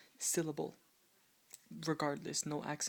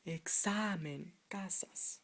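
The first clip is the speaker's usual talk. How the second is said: "examen, casas" said ex-SA-men, CA-sas; stress on second-last syllable; slower than usual. Shown as a demonstration. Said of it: "the accent is on the penultimate syllable".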